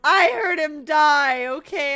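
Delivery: choked-up and distressed; upset, on the verge of tears. Real, not acted. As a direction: voice tight with real upset. Upset about dead rat.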